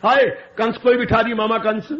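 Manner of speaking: usual speaking voice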